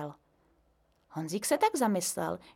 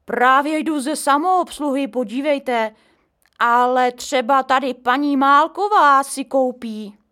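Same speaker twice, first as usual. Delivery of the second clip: loud caretaker; 5 semitones higher, 9 dB louder than usual. A narrator's reading. Caretaker points to neighbour.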